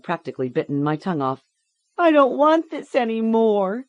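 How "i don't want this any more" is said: put-on high, loud child's wail. A narrator's reading. Tortured girl begs to stop, wailing. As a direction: wail it like a hurting little girl. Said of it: "she wailed".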